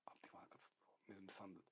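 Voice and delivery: tonlos geflüstert, vertraulich. Real, not acted. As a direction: senk die Stimme zu einem Flüstern. Heikles Thema, gesenkte Stimme. (vertrauliches Flüstern über die Kinder)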